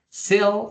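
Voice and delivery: "Sell" pitched high, single clean L; model "Sell". Shown as a demonstration. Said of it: "it comes L".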